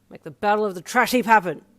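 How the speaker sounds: put-on loud drunken rallying cry; rallying shout. A narrator's reading.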